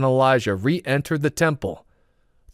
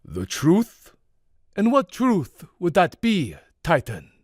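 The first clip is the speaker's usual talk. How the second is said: stern; 5 semitones higher than usual. A narrator's reading. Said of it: "His gaze was piercing".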